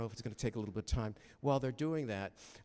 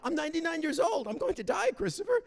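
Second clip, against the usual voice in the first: much higher, louder mock voice of a ninety-nine-year-old mother; imitating his mother; 6 dB louder than usual. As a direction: play his old mother insisting, pitched way up. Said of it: "Mom turned to me".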